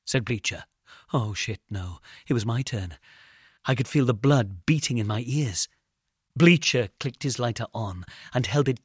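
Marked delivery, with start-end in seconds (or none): none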